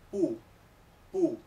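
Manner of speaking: pu with a sharp falling tone; fourth tone pu. Shown as a demonstration. Teacher models fourth-tone pu for students. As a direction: drop the pitch hard on pu. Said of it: "pues se pronuncia".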